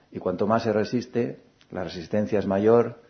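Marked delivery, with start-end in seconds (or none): none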